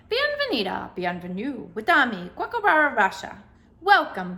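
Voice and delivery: booming announcer. A narrator's reading. Library ceiling speaker greeting the visitor, booming announcer. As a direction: boom it out like a library PA. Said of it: "boomed a voice from the ceiling speakers".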